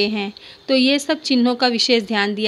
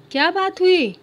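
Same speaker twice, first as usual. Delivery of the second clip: higher questioning aunt voice; aunt asking; 8 semitones higher than usual. Acted. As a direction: ask like a curious aunt. Teacher voices aunt's question. (बुआ जी का सवाल, ऊँची आवाज़ में)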